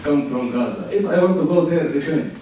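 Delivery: usual speaking voice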